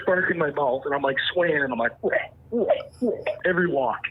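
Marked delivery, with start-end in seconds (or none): 2.0-3.1 short repeated moose grunts; imitated bull grunt. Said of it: "I'm just gonna bull grunt".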